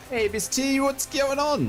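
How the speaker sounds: high, bright streamer greeting; excited greeting. Real, not acted. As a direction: call out a cheery hello. Calling out greeting to viewer.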